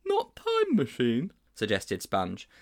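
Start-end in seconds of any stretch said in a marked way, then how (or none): 0.1-1.3 put-on high boyish voice, much higher; a puzzled schoolboy asking his teacher; high, wide-open boy's question; Sponge's voice. A narrator's reading. Sponge suggests plainer name.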